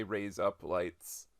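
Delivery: "raise up lights" drawn out word by word; slow demo. Shown as a demonstration. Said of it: "if you say that".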